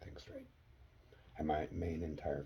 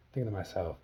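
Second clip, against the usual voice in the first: ordinary talk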